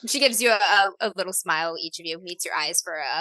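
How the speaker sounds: ordinary talk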